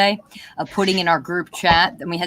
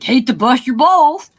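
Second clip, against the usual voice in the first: teasing quote; 7 semitones higher, 6 dB louder than usual. Retold or imitated. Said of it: "I said".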